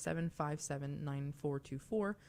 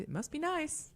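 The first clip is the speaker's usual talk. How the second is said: higher singsong jab; teasing, put-on envy; mock envy; 13 semitones higher than usual. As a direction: mimic a jealous catchphrase. Husband mimics her envious catchphrase.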